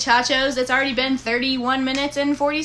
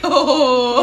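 worm impression; 9 dB louder than usual. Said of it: "he was like".

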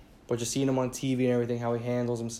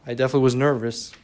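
louder, faster, pressing on was; emphatic repeat; 7 dB louder, faster than usual. Real, not acted. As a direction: insist on it, pushing the words out. Repeats admission with more force.

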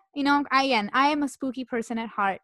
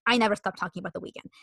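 usual speaking voice

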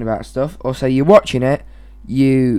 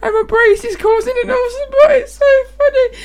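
very high, tearful laughing voice; helpless laughter. Real, not acted. Girlfriend never laughed so much, crying with laughter. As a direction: squeak words out through real laughter. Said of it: "never laughed so much".

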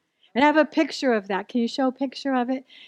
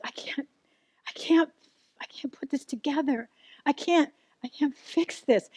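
hushed, near-whispered despair; barely voiced, breaking at each "I can't"; retold sobbing; 7 dB quieter than usual. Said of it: "and I am sobbing".

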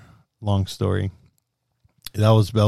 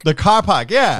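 higher-pitched mock Boston accent; 8 semitones higher, 3 dB louder than usual. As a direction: echo it in broad Bostonian, R's dropped. Echoes the Boston-accent commercial.

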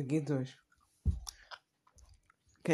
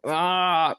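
pained laugh; 3 semitones higher, 4 dB louder than usual. Real, not acted. Reacting to sour candy.

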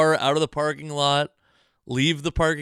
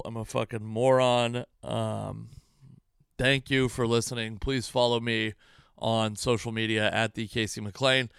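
usual speaking voice

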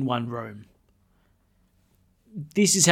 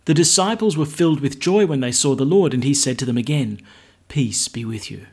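louder formal gospel reading; gospel proclamation; 5 dB louder than usual. Acted.

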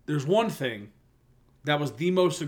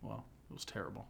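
whisper; 3 semitones lower, 15 dB quieter, faster than usual. Real, not acted. Teasing cohost in an aside.

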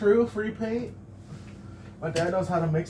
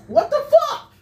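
high, loud startled outburst; shocked; 7 dB louder than usual. Real, not acted. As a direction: blurt it out in disbelief. Surprised at being called quiet.

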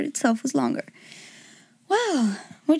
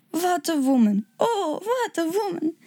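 put-on exclaiming king with a German accent; lilting German-accented exclamation; 7 semitones higher, slower than usual. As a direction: cry out in admiration, German-accented. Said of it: "I hope you liked my German accent".

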